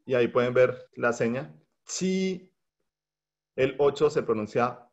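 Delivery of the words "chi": "chi" said high and soft; Mandarin qī. Shown as a demonstration. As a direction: say "chi" with a high level Chinese tone. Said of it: "se pronuncia chi".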